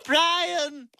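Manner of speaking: high, drawn-out call; high-pitched demanding holler. Acted. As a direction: summon someone from another room. Calling again after an aside.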